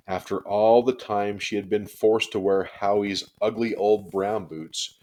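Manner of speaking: ordinary delivery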